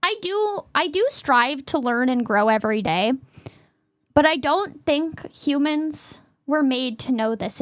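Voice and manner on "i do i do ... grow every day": ordinary talk, nothing marked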